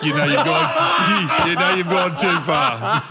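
loud, drawn-out words through laughter; slow it down and laugh through it; laughing tease. Real, not acted. Teasing a friend over mowing the neighbour's grass, laughing.

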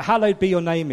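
high, loud declaration of the prayer; loud proclamation. Acted. Preacher booming the prayer to the congregation, loud. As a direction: call it out to the room.

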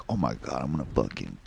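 low, soft mutter to oneself; deep and quiet, played down; retold excitement, muted. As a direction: quote an old thought under the breath. Retelling own past overconfident thought.

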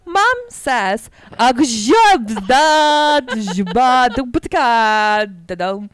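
gibberish in a mock Russian accent read by a phone; hammed-up Russian accent dictation; bad Russian accent. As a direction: garble it like a robot reading Russian badly. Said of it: "with the worst Russian accent".

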